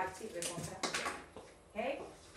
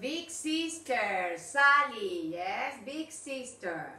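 each word set apart, slow and clear; careful teacher demo of big sister; slow model phrase; 3 dB louder, much slower than usual. Shown as a demonstration. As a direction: say it slowly so the class can copy. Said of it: "teacher models the phrase".

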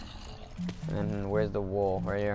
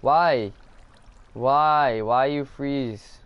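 frustrated shouting; 4 semitones higher, 5 dB louder, much slower than usual. Real, not acted. Game glitch frustrates player.

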